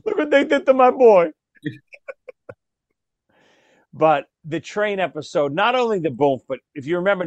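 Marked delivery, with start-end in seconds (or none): 0.1-1.3 high, loud wailing mother; mock wailing mother. Son retelling his mother's lament after the altar, hammed-up wail. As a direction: cry it out like a distraught mom, hammed up. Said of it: "did she say".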